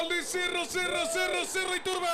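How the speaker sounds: high, loud goal scream; breakneck goal commentary. Real, not acted. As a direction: erupt into the goal call. Goal scored, commentator erupts.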